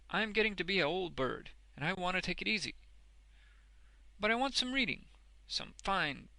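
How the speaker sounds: high, loud, bustling character voice; exasperated, then enthusiastic Boffin. A narrator's reading. Boffin wants fine bold reading, enthusiastically. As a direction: burst out, then warm to your wish.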